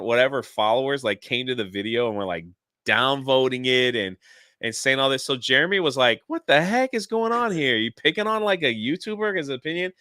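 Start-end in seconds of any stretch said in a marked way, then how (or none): 6.3-9.9 retold indignant question, pitched up high; high, indignant what-the-heck retelling; incredulous outrage. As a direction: imitate a friend's disbelief at the bullying. Friend retold defending the speaker.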